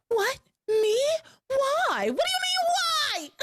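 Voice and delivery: hammed-up shocked protest; mock outrage. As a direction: play her wounded innocence, pitch shooting up. Voicing Grace's indignant disbelief.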